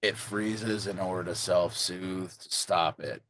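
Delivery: low, pensive character voice; low-voiced game character. Player guessing at the mystery in character, low and pensive. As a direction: speak low, pausing between guesses. In-character speculation, lower than own chat.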